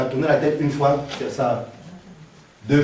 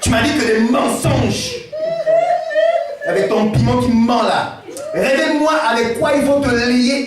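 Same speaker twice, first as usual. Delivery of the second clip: put-on ivory accent, higher, with laughs; Dalila ivoirienne énervée; 8 semitones higher than usual. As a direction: play Dalila fed up with an Ivorian accent. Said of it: "Là, elle devient ivoirienne". (Dalila énervée avec un accent ivoirien)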